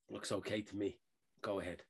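low, very soft retold voice; calm reassurance. Sensible airline worker waving a passenger through, calm. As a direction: drop right down, quiet and unbothered.

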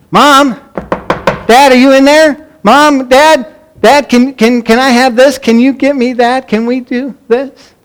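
high, loud calls running into insistent pleas; kids calling out. Retold or imitated. Kids seeking parents behind a shut door, mock calling out. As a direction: imitate children hollering through a closed door. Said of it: "And what would they do?".

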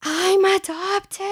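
put-on a boy realizing he is adopted; child's gasp. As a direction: drag it out in wide-eyed shock. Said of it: "he was like".

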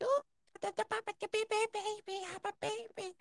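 put-on very high, soft squeaky baby talk; mock her baby voice; squawky baby voice. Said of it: "really squawky, like, baby voices".

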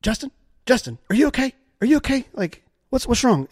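high, alarmed big-sister voice; worried sister. Retold or imitated. Sister on the phone hearing him dazed and hurt, high and alarmed. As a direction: call his name high and frantic, over and over. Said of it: "I hear her go".

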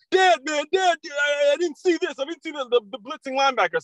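high whiny pleading voice; whiny kid excuses. Retold or imitated. Son making excuses to his coach dad, high whine. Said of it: "He goes".

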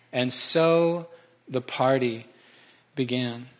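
drawn-out, slow savoring of the line. Shown as a demonstration. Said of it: "It's my favorite verse".

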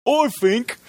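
high, hammed-up Cockney voice; Monty Python English accent. Showing co-host the accent, Monty Python English voice. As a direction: squawk it in a silly English accent. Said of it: "a little bit of the Monty Python".

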